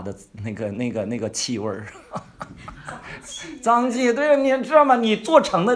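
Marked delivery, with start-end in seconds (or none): 2.1-3.6 a high, airy burst of laughter; high breathy laugh. Real, not acted. Preacher laughing at the image of legalism's smell. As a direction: laugh it off lightly, unvoiced.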